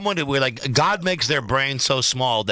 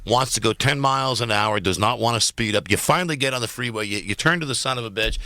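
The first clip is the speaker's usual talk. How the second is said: usual speaking voice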